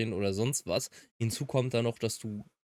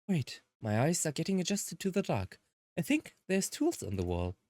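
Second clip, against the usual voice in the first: lightly voiced game characters, raised pitch; lightly acted visual novel cast; character dialogue; 8 semitones higher than usual. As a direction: read the heroes' lines as their own voices.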